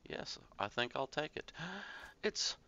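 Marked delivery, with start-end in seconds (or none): 1.6-2.2 quick, high gasp; sharp intake of breath; surprised gasp. Real, not acted. Surprised reaction while playing.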